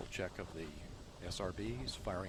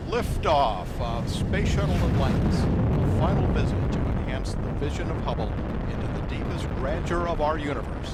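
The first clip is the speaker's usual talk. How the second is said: excited liftoff call; 6 semitones higher, 15 dB louder than usual. Real, not acted. Launch commentator calls the liftoff.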